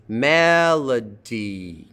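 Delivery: Melody very slow, long MEL, weak middle; slow falling Melody, stressed at the front; unstressed middle syllable. Shown as a demonstration. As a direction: stretch Melody out, keeping the LO light.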